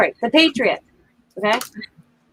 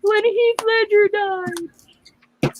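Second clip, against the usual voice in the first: imitating tearful daughter; 11 semitones higher, 3 dB louder, slower than usual. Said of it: "She goes".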